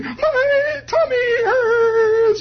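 very high, slow, loud wailing doll; a toy doll crying out in pain; squealing doll moaning about its tummy; tortured doll voice. Retold or imitated. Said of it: "The doll is twisted over and is saying".

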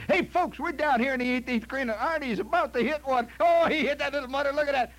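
loud, high, brash TV golf announcer; loud announcer call. Retold or imitated. Comic mocking a loud golf announcer who shouts. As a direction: yell it like a rowdy sportscaster. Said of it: "No, you don't hear this".

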